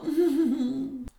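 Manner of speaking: high giggling laugh; a mocking high little laugh; girlish laugh. Stepsisters laugh off.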